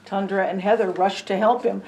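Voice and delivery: plain reading voice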